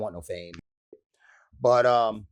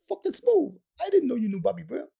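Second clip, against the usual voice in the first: very high, fast impressed imitation; high comic amazement; amazed friend; 12 semitones higher than usual. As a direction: gush in a squeaky put-on voice. Eric amazed.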